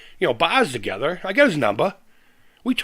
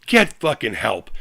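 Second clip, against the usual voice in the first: normal talking voice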